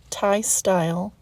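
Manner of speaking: "style" slowed and leaned on, as if in quotation marks; stress on style. Shown as a demonstration. Cook telling viewers the curry isn't authentic, stressing "style". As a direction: hit the word "style" to hint the dish is only loosely Thai. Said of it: "I emphasize the word style".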